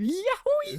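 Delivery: squeaky, very high cry of glee; child flinging the hamster with a yelp; high yowie yelp. Retold or imitated. Hamster thrown out the window.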